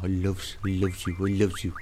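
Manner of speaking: put-on silly baby-talk pet voice; talking to a dog. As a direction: dote on the puppy lovingly. Cooing at puppy dog.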